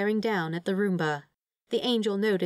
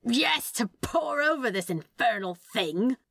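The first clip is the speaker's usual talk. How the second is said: higher, slow mock-furious shout; mild yell; 5 semitones higher, slower than usual. A narrator's reading. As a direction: shout back from across the flat. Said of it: "Crowley yelled".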